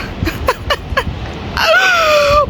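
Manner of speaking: high cackle into a long squeal; laugh, then squeal high and long; excited laugh. Real, not acted. Reacting to a stunning view.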